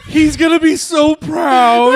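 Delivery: high, loud, slow teasing shout; excited shout. Real, not acted. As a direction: cheer it out in delight. Laughing tease about the dad.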